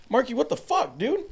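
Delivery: high, loud disbelieving cry; shocked outburst. Retold or imitated. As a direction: blurt it out in shock. Shocked at crack cocaine.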